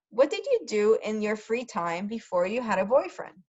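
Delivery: ordinary talk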